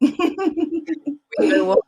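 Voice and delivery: high, slow words through laughter; laughing. Real, not acted. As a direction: laugh it out, overwhelmed with joy. Host laughs, overwhelmed.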